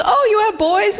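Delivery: high, loud chirpy stranger voice; mock excited woman. Woman overhearing at a party, mock excited and high. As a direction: play the nosy woman, bright and eager.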